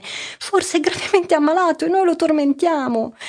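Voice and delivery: a frightened mother near tears; high, worried and tearful. A narrator's reading. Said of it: "esclamò la madre già in lacrime".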